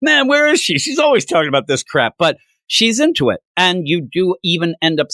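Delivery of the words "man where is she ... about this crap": mock grumble, starting high; lightly teasing retold complaint; half making fun. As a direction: imitate someone half-joking about a colleague. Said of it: "kinda like half making fun".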